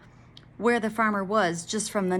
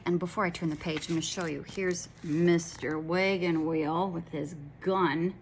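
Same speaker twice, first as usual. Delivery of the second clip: usual speaking voice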